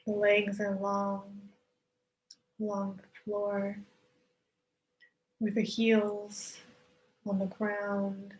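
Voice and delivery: calm yoga nidra voice; guided meditation. Acted. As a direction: ease listeners into stillness, unhurried. Leads guided relaxation with long pauses.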